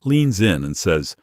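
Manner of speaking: ordinary delivery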